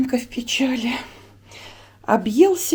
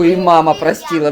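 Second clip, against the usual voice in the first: ordinary talk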